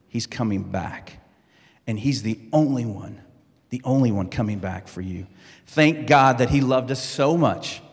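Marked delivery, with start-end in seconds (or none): 0.1-5.2 low and soft, the phrases set apart by pauses; hushed intimacy. Acted. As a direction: draw them in with a quiet, lower promise. Preacher drops to intimate emphasis.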